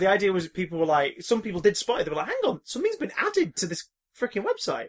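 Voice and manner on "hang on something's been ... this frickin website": high-pitched mock astonishment; startled, high-pitched double take; surprised fans. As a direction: act out someone suddenly spotting it. Acting out fans spotting the listing.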